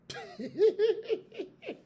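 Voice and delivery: real laugh rising high; high burst of laughter. Real, not acted. Laughs at own trick.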